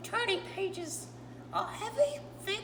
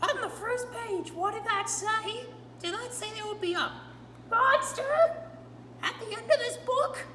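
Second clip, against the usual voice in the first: Grover voice; 2 semitones higher, 4 dB louder than usual. Acted. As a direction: play Grover, nervous and pleading with the reader. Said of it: "told by my friend Grover".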